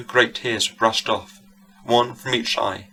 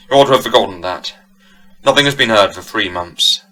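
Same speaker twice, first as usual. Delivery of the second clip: impatient scolding; 6 dB louder than usual. A narrator's reading. Squire scolds his wife.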